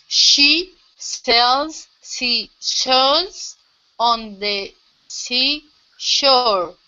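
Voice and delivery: learner slowly copying the teacher word by word; slow, careful repetition. Shown as a demonstration. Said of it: "Go a little bit slower".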